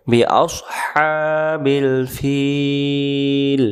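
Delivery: very slow, drawn-out tajweed chant; Quran recitation. Shown as a demonstration. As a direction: stretch each syllable like recitation. Recites verse.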